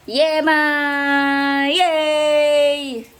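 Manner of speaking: high, loud, drawn-out cheering; excited cheer. Real, not acted. Mother cheering up her child over yema, excited cheer.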